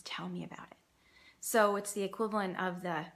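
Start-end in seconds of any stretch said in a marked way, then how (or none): 0.0-0.8 gently whispered invitation. Shown as a demonstration. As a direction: whisper it softly to a child. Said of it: "give them an alternative".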